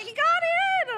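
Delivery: delighted mother announcing; excited. Retold or imitated. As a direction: burst out the good news. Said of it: "she's like".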